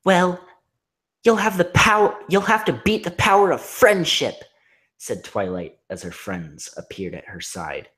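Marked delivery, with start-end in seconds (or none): 0.1-4.4 defiant heroine. Acted. Twilight defying monster.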